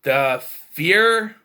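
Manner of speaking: loud disbelieving question; incredulous. Real, not acted. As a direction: scoff it back in disbelief. Mocking the claim incredulously.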